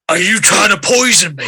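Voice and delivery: loud, accusing orc voice; angry orc. Acted. Orc to the cook who tried to poison them, angry. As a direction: demand it loudly as an offended orc. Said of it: "as the angry orc".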